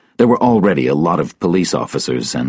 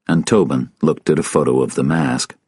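ordinary delivery, nothing marked

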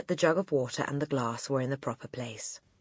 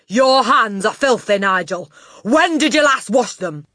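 loud, high-pitched telling-off; angry scolding; 8 semitones higher, 13 dB louder than usual. A narrator's reading. Headmistress finding filthy hands, loud angry scolding. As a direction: scold him at full volume.

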